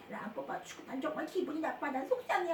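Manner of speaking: very soft, quick grumble; quiet inward sulk. Shown as a demonstration. Teacher showing silent sulking, acted quiet upset grumble. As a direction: grumble softly as if only to yourself. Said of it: "조용히 속으로만".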